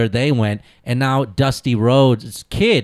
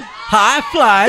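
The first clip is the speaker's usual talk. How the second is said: excited promo echo; 9 semitones higher than usual. Real, not acted. Echoing the promo along.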